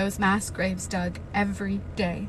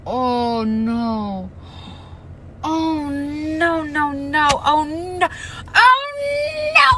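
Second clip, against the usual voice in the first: rising, near-tearful dismay; distressed; 7 semitones higher, 7 dB louder, much slower than usual. Real, not acted. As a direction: plead against the news. Reader dismayed, near tears.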